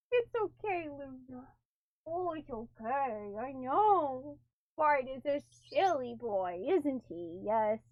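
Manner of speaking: soft, low comfort for a startled pet; calm the cat down gently, almost inaudible; soothing the cat. Real, not acted.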